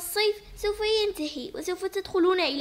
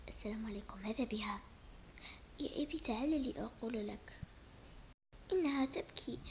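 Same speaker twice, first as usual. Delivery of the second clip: hushed unvoiced whisper; 5 semitones lower, 14 dB quieter than usual. Child whispering to father that sister cries, in a hushed whisper. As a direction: whisper it so no one hears.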